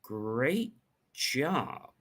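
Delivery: soft, halting, uneasy compliment; doubtful praise. Shown as a demonstration. Coach hinting at a problem, doubtful. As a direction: stretch it out quietly, as if there's a problem. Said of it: "That indicates that there could be a problem".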